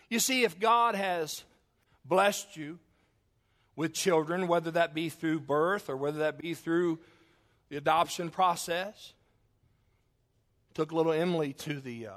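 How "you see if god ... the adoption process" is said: louder, higher, slow pulpit delivery; preaching. Acted. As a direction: preach it out slowly to the congregation. Preaching opening point.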